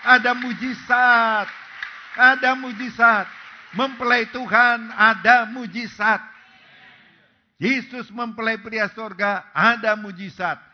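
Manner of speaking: loud, slow, rising preacher's cry; preaching at full force. Acted. Preacher to congregation, crescendo of full-force preaching.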